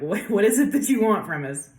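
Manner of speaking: exasperated retold question, voice lifting; mock exasperation. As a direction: play an irked spirit wanting an answer. Voicing the irritated summoned spirit.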